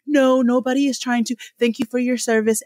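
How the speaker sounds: high, sugary customer service voice; mock customer service voice. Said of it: "They're like".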